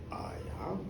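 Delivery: very low and quiet; ominous confirmation. Acted. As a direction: confirm it darkly, deep and soft. Tall gentleman reveals himself.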